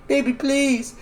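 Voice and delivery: high, pleading no; high mock-anguished plea; retold desperate scream. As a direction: beg her not to in a high cry. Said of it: "Talia's screaming".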